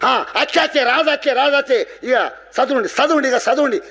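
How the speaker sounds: high-pitched excited villagers' chatter; excited announcing. Acted. Villagers announcing that Raju has come, excited. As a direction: pass the news on in a high, urgent voice.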